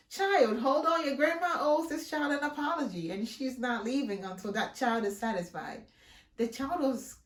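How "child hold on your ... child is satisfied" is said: loud, firm telling-off; raised firm voice; stern scolding. Retold or imitated. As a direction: lay down the law to the child. Stern speech to the boy.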